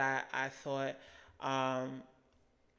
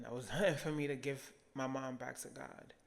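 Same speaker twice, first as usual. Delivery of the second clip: ordinary talk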